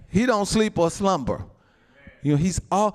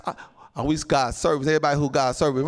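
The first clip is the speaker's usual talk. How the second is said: ordinary talk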